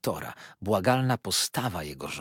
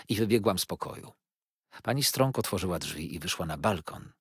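ordinary delivery, nothing marked

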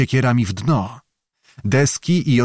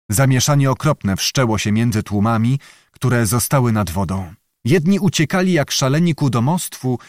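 ordinary delivery, nothing marked

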